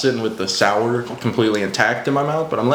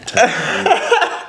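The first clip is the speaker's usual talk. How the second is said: laughing; 14 semitones higher, 4 dB louder than usual. Real, not acted. Spotting fizz in candy.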